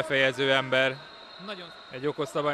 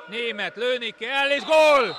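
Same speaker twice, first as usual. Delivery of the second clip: very high, loud goal shout; excited goal call; 8 semitones higher, 4 dB louder, slower than usual. Real, not acted. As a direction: explode on the goal. Goal called in live commentary. (izgatott gólkiáltás)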